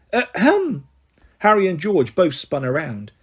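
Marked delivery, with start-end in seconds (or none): 0.1-0.9 high, dainty ahem; prim little throat-clear. Acted. Umbridge interrupting McGonagall, prim ahem.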